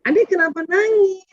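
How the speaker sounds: a mother's questioning voice, pitched high; mother asking her crying child. Retold or imitated. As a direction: ask the crying child why. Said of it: "kalau kemarin kan gini". (ibu bertanya dengan nada tinggi)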